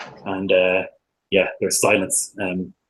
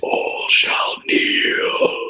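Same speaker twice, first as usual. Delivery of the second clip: very slow, drawn-out commanding whisper; eerie whisper; much slower than usual. Acted. Game master as the tapestry's evil voice, slow eerie whisper. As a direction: whisper it slowly, stretching each word. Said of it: "the whispers that you continue to hear".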